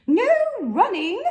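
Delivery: loud, high reflex call; calling after. Acted. Head librarian calling after McBee, loud and high. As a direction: shout the rule after him.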